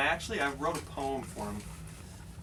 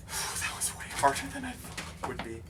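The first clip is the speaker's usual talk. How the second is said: normal talking voice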